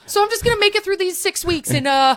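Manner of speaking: mock cheerful. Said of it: "And I was just like".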